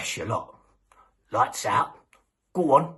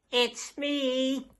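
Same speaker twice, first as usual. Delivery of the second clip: put-on wolf faking a much higher old nan voice; silly voice. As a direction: squeak it out like a wolf pretending to be Nan. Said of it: "puts on a silly voice".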